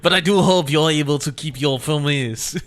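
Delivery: hammed-up villainous French accent; a smug French villain, slightly over the top. Latour warning Catherine in a villain French accent. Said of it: "more villain French now".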